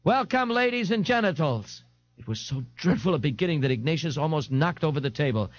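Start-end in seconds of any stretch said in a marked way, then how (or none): 0.0-1.8 higher voiced hostess welcoming the crowd; brassy club owner. A narrator's reading. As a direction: play the club owner opening the show. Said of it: "the Nazi proprietress was saying".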